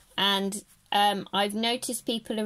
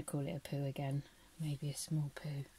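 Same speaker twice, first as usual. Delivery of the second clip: cheeky whispered joke, much softer; whispered aside; 6 semitones lower, 13 dB quieter than usual. Real, not acted. Whispering a cheeky joke to the kids, softly. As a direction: slip it in as a naughty whisper.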